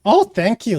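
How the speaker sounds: high little fairy voice; high-pitched tiny creature; squeaky pixie. Acted. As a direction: squeal thanks like a flattered pixie. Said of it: "says the pixie".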